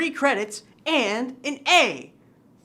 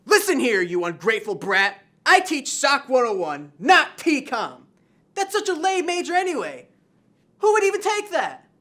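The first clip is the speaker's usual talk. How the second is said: mock angry teacher; 5 semitones higher, 5 dB louder than usual. Teacher scolds a student.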